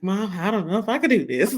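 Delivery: a hint of a trembling child; nervous boy voice, lightly done; lightly voiced scared kid. Mother imitating her son's nervous plea before the ceremony, mildly. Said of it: "he was really trembling".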